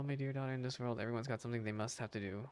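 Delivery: ordinary delivery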